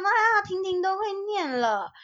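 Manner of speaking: high, delighted aunt's praise; aunt praising. Retold or imitated. Aunt proudly praising Tingting to family, high and delighted. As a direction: gush over the clever little niece. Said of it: "她就说".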